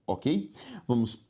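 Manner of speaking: usual speaking voice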